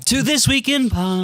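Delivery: put-on British accent. Host intro in British voice.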